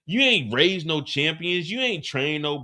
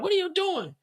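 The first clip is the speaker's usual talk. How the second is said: much higher, strained mock yell; imitating yelling; 13 semitones higher than usual. Shanti bursts in, yelling at Jannard. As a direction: shriek the question at him. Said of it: "Shanti start yelling".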